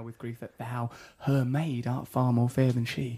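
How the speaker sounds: soft, slow theatrical verse; Shakespeare recital. Acted. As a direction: recite it like a stage lover, softly. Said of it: "Bit of Shakespeare".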